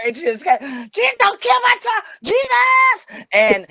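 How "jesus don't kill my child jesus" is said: high shouted plea, cracking with fear; frantic pleading. Retold or imitated. Grandmother begging the trooper not to kill her son, shrieking. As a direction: scream the plea to Jesus, much higher and louder. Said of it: "She just went berserk".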